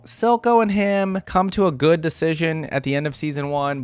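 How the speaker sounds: ordinary talk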